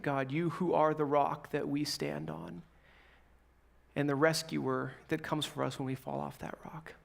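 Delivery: hushed, unhurried prayer with long pauses. Real, not acted. Pastor praying before the message, reverent and subdued. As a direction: pray quietly, pausing between phrases.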